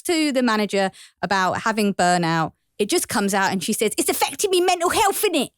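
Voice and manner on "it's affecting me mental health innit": higher-pitched mock Cockney accent; Cockney burst. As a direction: blurt it out in broad Cockney. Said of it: "people just burst into British".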